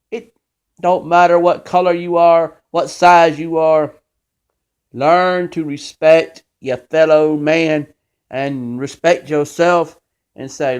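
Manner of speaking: ordinary talk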